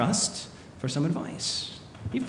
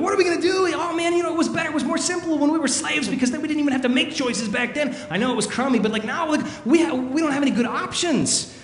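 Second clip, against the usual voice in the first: high-pitched mock-panicked whining; crying out, complaining; 11 semitones higher than usual. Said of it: "they're crying out, and they're complaining".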